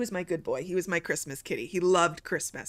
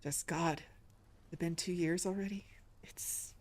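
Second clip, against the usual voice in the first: quietly emotional; 8 dB quieter than usual. Real, not acted. Streamer remembering their late cat, quietly emotional. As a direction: falter softly through the loss. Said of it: "I'm getting a little emotional".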